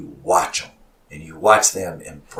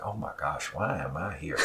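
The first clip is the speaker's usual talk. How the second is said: very soft, lower voice; imitated awkward aside; 4 semitones lower, 13 dB quieter than usual. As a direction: voice an uncomfortable onlooker's private thought under your breath. Onlooker's awkward silent thought voiced.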